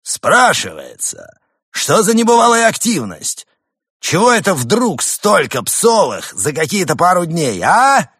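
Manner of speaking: high, loud indignant rant; indignant leader. Acted.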